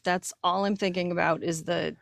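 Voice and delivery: normal talking voice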